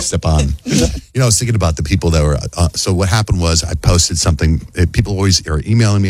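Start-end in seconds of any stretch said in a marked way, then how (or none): 0.0-0.5 "Stepan" lower, as "steh-PAHN"; teasing Stepan. Shown as a demonstration. Teasing the caller with the pronunciation.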